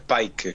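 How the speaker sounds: Pike said like "like" with a P; rhymes with like. Shown as a demonstration. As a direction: say Pike with an "eye" vowel. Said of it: "como like, pero con P".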